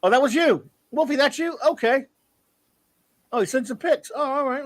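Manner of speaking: suddenly realizing who sent it; surprised realization. Real, not acted. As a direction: caught off guard, pitch jumps up. Realizes the sender was Wolfie.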